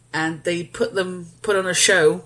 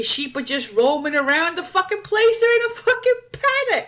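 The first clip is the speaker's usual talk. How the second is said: exasperated; 11 semitones higher than usual. Acted. Exasperated high outburst.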